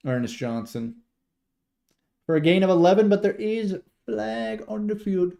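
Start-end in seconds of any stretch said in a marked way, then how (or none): 2.3-5.3 higher-pitched announcer call; live play-by-play. Real, not acted. Streamer reports the gain and a flag, raised play-by-play.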